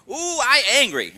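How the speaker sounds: put-on slow, loud, high Korean-accented outburst; broken Korean-English. Korean master announcing her anger to class, broken Korean-English. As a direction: draw out the mock-angry Korean accent. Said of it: "she talks sort of broken Korean".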